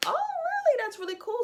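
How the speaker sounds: very high, swooping "oh really"; impressed surprise. Retold or imitated. Woman reacting to his official language, impressed surprise. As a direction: play up polite amazement way up high.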